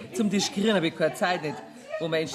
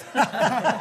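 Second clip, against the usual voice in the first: hearty laugh at his expense; mocking laugh. Mocking the banker's office request.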